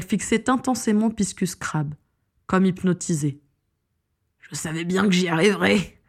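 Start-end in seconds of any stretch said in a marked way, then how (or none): 4.4-6.0 gloating, acted exultation at usual volume; hammed-up villainous triumph; exultant villain. A narrator's reading. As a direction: crow over your success in a villain's voice. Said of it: "exulta Piscus".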